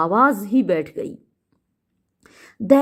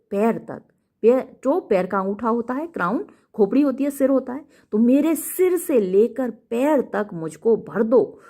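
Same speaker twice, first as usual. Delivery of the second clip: ordinary talk, nothing marked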